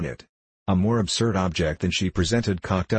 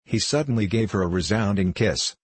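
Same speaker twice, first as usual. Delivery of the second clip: ordinary delivery, nothing marked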